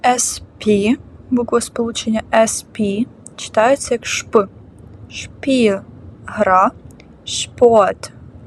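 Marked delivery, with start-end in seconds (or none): none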